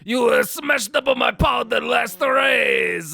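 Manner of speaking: loud, high hammed-up alien junk dealer; French Watto. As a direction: bark it like a greedy alien with a French twist. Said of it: "transitioning to Watto".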